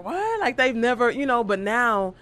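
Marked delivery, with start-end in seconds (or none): 0.0-0.4 a much higher, puzzled "What?"; mock surprise. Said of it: "People like".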